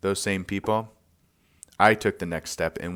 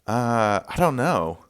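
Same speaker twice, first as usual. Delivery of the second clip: unsure, higher-pitched answer after a pause; retold uncertain answer, pitched up; hesitant; 5 semitones higher, slower than usual. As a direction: give a puzzled, searching reply. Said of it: "He paused and said".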